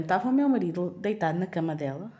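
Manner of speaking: ordinary delivery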